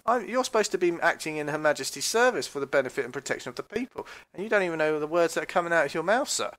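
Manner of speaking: high, loud challenge to an officer; mock indignant outburst. Commentator role-playing a detainee challenging the officer, indignant. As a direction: act out protesting with voice pitched right up.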